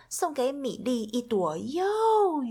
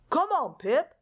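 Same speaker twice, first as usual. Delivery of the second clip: raised, beckoning call; a mild call across to a friend; 5 semitones higher than usual. A narrator's reading. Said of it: "he called".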